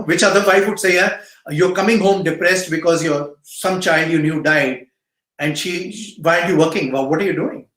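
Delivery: ordinary talk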